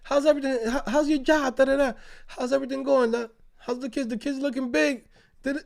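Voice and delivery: high, bright run of neighborly small talk; mimicking folks who greet everyone; friendly neighbor chatter. Old block neighbors catching up, mock cheerful chatter. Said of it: "Hear everybody talking".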